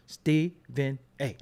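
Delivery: "Stephen A." said slowly, one syllable at a time; counting syllables. Shown as a demonstration. Counting the syllables of "Stephen A." slowly for the audience. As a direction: spell out "Stephen A." beat by beat. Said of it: "let me count 'em for you".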